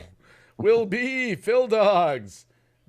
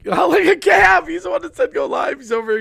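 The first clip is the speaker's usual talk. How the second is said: excited; 12 semitones higher, 8 dB louder than usual. Real, not acted. Laughing call-out of yawning guest.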